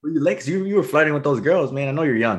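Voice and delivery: high, loud, hyped teasing buddy; teasing bro banter. Retold or imitated. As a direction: rib him like an old friend. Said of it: "they're excited like me. I do the same thing".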